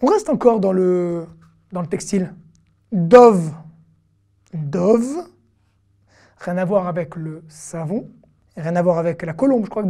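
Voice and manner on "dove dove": dove said clearly as dɔv, open o; model pronunciation. Shown as a demonstration. Teacher modelling dove for students. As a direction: say dove slowly and clearly for the class to repeat. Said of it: "teacher models the word".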